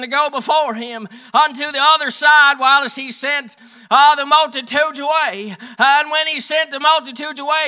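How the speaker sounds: loud, high scripture reading, easing at verse ends; preach. Real, not acted. Preacher reading Matthew to the congregation, preaching loudly.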